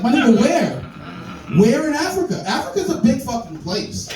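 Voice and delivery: indignant shout. Real, not acted.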